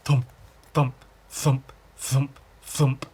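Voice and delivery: thumping sound. Acted. Imitating a rock thumping along.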